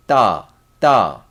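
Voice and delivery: ta with tense ㄸ, firm and stressed; tense Korean ta. Shown as a demonstration. Teacher repeats the doubled D, firm tense ta. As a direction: stiff pressed t in each ta. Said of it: "So this one is with the accent".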